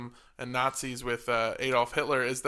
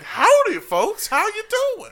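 cheery cowboy greeting; 7 dB louder than usual. Retold or imitated. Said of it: "next guy was like".

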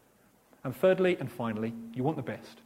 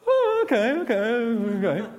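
high, loud squeal of surprise; can't believe someone said yes; stunned delight; 7 semitones higher, 6 dB louder, slower than usual. Retold or imitated. Teen astonished a classmate wants to come to the event.

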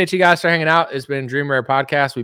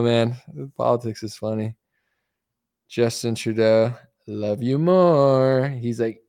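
ordinary delivery

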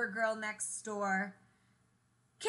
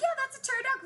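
insistent; 13 semitones higher, 4 dB louder, much faster than usual. Retold or imitated. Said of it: "I'm like".

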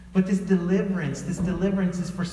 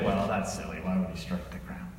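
mock skeptic; 12 semitones lower, 7 dB quieter than usual. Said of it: "And some people say".

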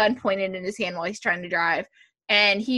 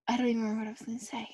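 soft, hushed and quick to oneself; real near-whisper, rushed; muttered aside; 9 dB quieter, much faster than usual. Real, not acted. Speaker losing her thread, hushed aside.

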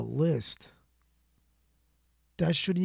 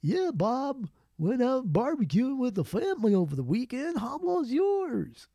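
high, fake-cheery office small talk, slowing on the question; sugary mock chit-chat with a smile you can hear; goofy fake-friendly coworker; 9 semitones higher than usual. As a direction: play the grinning coworker faking interest. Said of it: "put on that goofy smile on your face".

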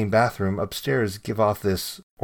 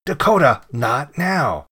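loud, higher call on the name, easing after; annoyed yell; 5 semitones higher, 5 dB louder, slower than usual. A narrator's reading. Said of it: "in an annoyed voice".

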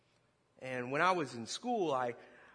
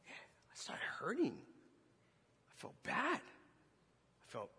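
hushed confession; 4 semitones lower, 7 dB quieter than usual. Real, not acted. Preacher confessing his guilt to the congregation in a whisper. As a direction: drop to a whisper as the guilt hits.

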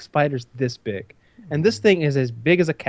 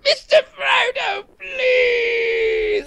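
very high, slow sobbing wail; mock desperate pleading; much slower than usual. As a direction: beg in a hammed-up tearful falsetto. Plays dying Sam pleading.

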